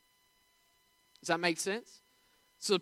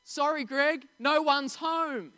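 mum's fib; 7 semitones higher, 3 dB louder, slower than usual. Retold or imitated. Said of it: "She said".

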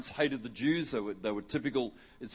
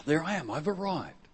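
normal talking voice

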